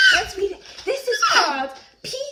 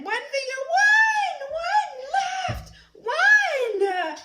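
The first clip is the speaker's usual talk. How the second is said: excited counting; 10 semitones higher, slower than usual. Real, not acted. Counting with child.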